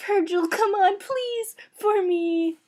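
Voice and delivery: high, wheedling plea; pleading. Acted. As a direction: beg him sweetly for a favor. Begging Virgil for a favor.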